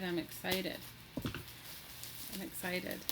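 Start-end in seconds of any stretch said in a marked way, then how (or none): 1.2-3.0 slow, breathy whisper; hushed, giddy whisper; whispered excitement. Real, not acted. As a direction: whisper it slowly to yourself, thrilled.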